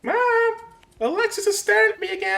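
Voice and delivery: high frantic yell for mom; mock scream. Echoes screaming girl in clip.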